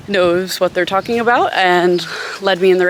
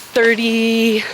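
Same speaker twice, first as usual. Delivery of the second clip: ordinary delivery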